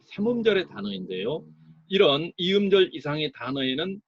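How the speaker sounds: ordinary talk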